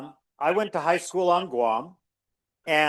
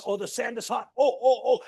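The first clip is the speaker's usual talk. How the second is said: walking on hot sand; 7 semitones higher, faster than usual. Pretend. Pretending to walk on hot sand.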